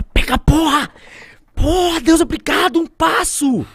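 high, loud shout of thanks; a wild cry of relief; triumphant yell. Retold or imitated. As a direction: yell your gratitude at the sky. Retells triumphant yell at God.

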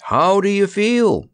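high, drawn-out concerned call; solicitous call. A narrator's reading. Scarecrow checks on the tethered lion, solicitous call. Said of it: "he called solicitously".

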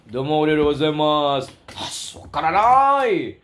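slow, drawn-out Japanese impression; mock Japanese accent. Host showing Japanese to listeners, mock Japanese accent. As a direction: stretch out pretend Japanese intonation. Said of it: "mga Japanese ka".

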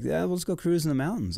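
buddy's invite. Retold or imitated. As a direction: toss out the plan like a carefree buddy. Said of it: "and like".

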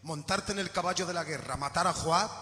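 low, soft voice of a broken-hearted king; David, tender and firm. Acted. David's long reply to Joab.

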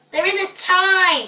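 high, loud, drawn-out objection; slow, frantic high protest; urgent protest. A narrator's reading. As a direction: stretch it out in desperation. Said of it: "hissed Ping".

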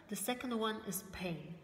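ordinary talk, nothing marked

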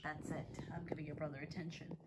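fast whispered aside. Real, not acted. Owner explaining to viewers, fast whisper. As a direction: whisper it quickly.

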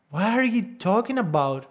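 can't believe how fast it was; rising disbelief. Real, not acted. Vlogger in mounting disbelief at the two-minute website.

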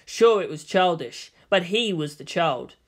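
ordinary delivery

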